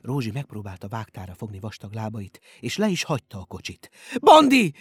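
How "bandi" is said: high, loud desperate call; piercingly high yell; crying out. A narrator's reading. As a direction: shout the name after someone being taken away. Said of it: "kiáltotta".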